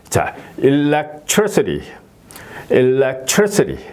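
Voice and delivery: very slow electricity, level then up on TRI and down; drawn-out electricity, flat then peaking on TRI; stress on third syllable. Shown as a demonstration. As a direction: stretch electricity: level start, climb to TRI, fall away. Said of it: "이런 식으로 발음이 되는 거죠".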